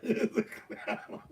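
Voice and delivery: slow, breathless laughing through the words. Real, not acted. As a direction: giggle out the words. Laughs while speaking.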